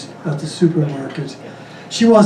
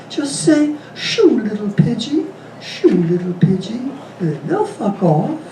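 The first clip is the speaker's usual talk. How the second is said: high, slow sing-song granny, breaking into laughter; sweet old lady; 4 semitones higher than usual. Acted. Old lady teaching the boy, sweet and slow.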